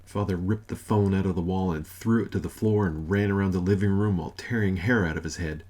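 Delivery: usual speaking voice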